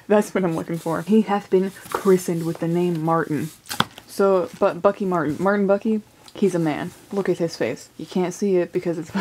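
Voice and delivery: ordinary talk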